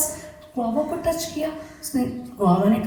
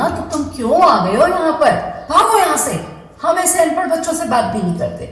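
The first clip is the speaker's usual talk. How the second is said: scolding apple; 6 semitones higher, 3 dB louder, faster than usual. Acted.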